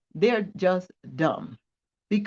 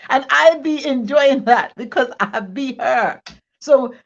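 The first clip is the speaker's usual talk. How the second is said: laughing through words; 4 semitones higher, 7 dB louder than usual. Real, not acted.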